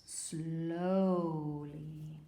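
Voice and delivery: "slowly" drawn right out; very slow, lingering "slowly"; slow, stretched word. Shown as a demonstration. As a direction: drag out "slowly" like a turtle's walk. Demonstrates the word's meaning.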